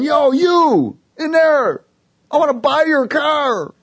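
high, loud, stretched-out holler; retold yell. Stranger parked way out calling to me, imitated yell. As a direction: imitate a man yelling across a park. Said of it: "the dude's yelling at me".

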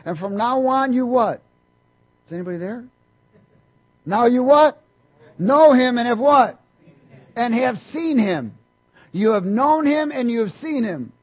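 loud, high prompting of the congregation; call and response preaching. Acted. Preacher prompting the church to finish the verse, loud. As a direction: demand the missing word from the crowd.

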